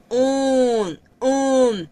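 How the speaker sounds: nasal un; French nasal demo. Shown as a demonstration. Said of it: "Sonido nasal".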